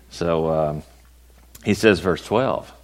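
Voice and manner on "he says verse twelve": usual speaking voice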